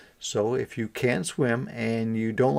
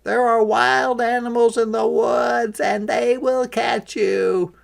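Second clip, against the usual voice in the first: high, louder warning duck voice; worried ducklings; 12 semitones higher, 5 dB louder than usual. A narrator's reading. Ducklings warning the chick of the woods, high urgent. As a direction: warn him like anxious ducklings. Ducklings warn of wild animals.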